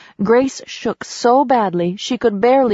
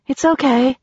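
higher voice putting on courage; brave; 6 semitones higher than usual. A narrator's reading. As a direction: reassure bravely, pitched up. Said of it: "she said bravely".

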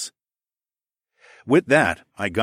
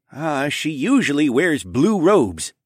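put-on slow, higher voice for the old king; lightly voiced king answering; king's voice; 8 semitones higher, 5 dB louder, slower than usual. A narrator's reading. King describing his missing sister-in-law, slow.

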